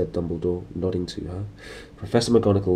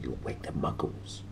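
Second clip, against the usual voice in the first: soft unvoiced hiss; stern hissed whisper; hissed warning; 6 semitones lower, 11 dB quieter than usual. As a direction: whisper it sharply, scolding. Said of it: "hissed Professor McGonagall".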